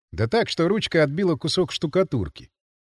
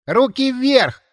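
put-on high, raised boyish order; boy's command; 7 semitones higher, 4 dB louder, much slower than usual. A narrator's reading. Boy sentry halts the stranger at the door, commanding. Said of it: "скомандовал стоявший на пороге мальчишка".

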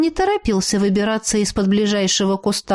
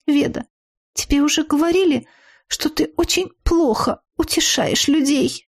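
put-on high, plaintive girl's voice; scared friend's reproach; 5 semitones higher than usual. A narrator's reading. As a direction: reproach her in a high, upset voice. Scared friend reproaches heroine, character voice. (высоким, жалобным голосом подруги)

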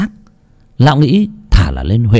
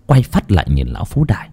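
usual speaking voice

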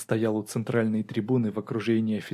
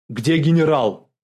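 loud, higher-pitched demand; shouted question; 4 semitones higher, 9 dB louder than usual. A narrator's reading. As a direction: shout it instead of asking. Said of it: "а прокричал". (прокричать вопрос)

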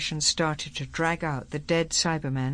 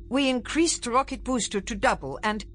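put-on higher-pitched, lightly accented formal English; precise, slightly accented English; 8 semitones higher than usual. A narrator's reading. Secretary explaining the rocket change, in precise, slightly accented English. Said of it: "in his precise, slightly accented English".

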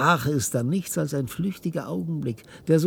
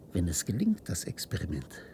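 hushed; 6 semitones lower, 5 dB quieter than usual. Acted. Dreadful secret.

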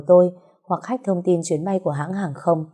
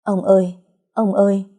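a louder, choked-up call to grandfather; narrated choked-up cry; choked stammer; 2 semitones higher, 4 dB louder than usual. A narrator's reading. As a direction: call out through a tight throat, mildly acted. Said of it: "chỉ có thể lắp bắp".

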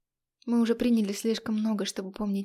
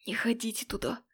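ominous warning; 5 dB quieter than usual. Acted. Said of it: "сделав страшные глаза".

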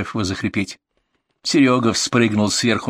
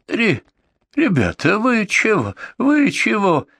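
frightened pleading; 12 semitones higher, slower than usual. A narrator's reading. Beaten drunk pleads in fear.

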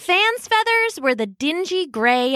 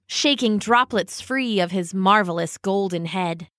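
ordinary delivery, nothing marked

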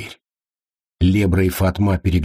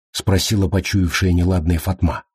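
plain reading voice

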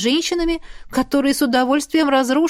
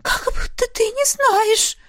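put-on much higher, slow, spiteful girl's voice; hissed reproach; 12 semitones higher, slower than usual. A narrator's reading. Isabel hisses at Ash before running off, slow and spiteful. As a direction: hiss it into his face. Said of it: "прошипела ему в лицо Изабель".

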